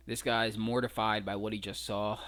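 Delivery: ordinary talk, nothing marked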